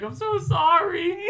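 very high, loud, drawn-out apology; mock apologetic driver. Driver mimicking the woman who hit the car, exaggerated apology. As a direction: imitate her in a squeaky, stretched-out wail.

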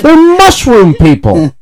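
high, drawn-out exasperation; exasperated insistence. Real, not acted. Podcaster arguing that toads have no gender, exasperated.

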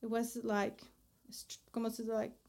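Spanish slipping into English mid-sentence; switching languages midsentence. Real, not acted.

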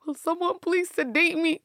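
high, pleading TV-drama quote; hammed-up desperate plea; Grey's Anatomy dramatics. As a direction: act out a distressed plea from a medical drama. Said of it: "if y'all watched that Grey's Anatomy".

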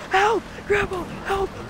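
high-pitched mock plea; imitated cry for help. Dreamer calls to grandma while being chewed by alligator, pleading. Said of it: "I'm, like, screaming".

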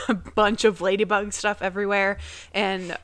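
normal talking voice

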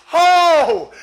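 long, high shouted "oh"; loud preacher's cry. Real, not acted. Preacher exclaiming to the congregation, long loud cry. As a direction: belt out a drawn-out cry. Preacher cries out mid-sermon.

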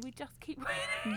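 quick repeat breaking into a laugh on winning; laughing brag. Real, not acted. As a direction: giggle through the brag.

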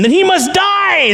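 very high, slow loud shout; crowd shouting. Acted. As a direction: demand it fiercely. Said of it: "the people said".

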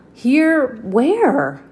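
loud, puzzled call back. Acted. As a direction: shout up, searching. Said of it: "he called back".

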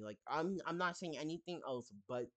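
ordinary talk, nothing marked

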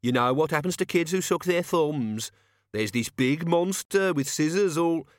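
odd mocking kid voice; childish jeer. Cat's Eye keeps jeering.

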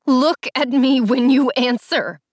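loud, high, slow demand; tearful demand. A narrator's reading. Allie demands that Jace face her, tearful demand. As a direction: demand it hard, drawing the words out. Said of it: "I demanded".

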